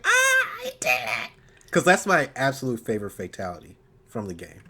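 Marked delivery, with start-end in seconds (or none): 0.1-1.3 squealing. Retold or imitated. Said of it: "I was like".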